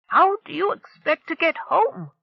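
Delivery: a touch of angry challenge; glaring demand. A narrator's reading. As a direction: glare up and demand it. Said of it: "glaring upward".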